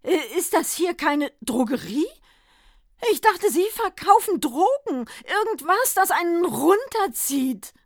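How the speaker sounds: high, loud, stammering dismay. A narrator's reading. Santa to the druggist, aghast and high. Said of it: "nicht minder entsetzt".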